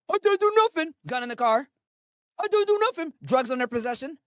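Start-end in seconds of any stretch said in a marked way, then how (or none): 0.1-0.9 higher, louder mimicked denial; mock protesting suspect. Streamer mocking suspects caught on body cams, mock protest. Mocks suspects denying their crimes. 2.4-3.1 higher, louder mimicked denial; mock protesting suspect. Streamer mocking suspects caught on body cams, mock protest. Mocks suspects denying their crimes.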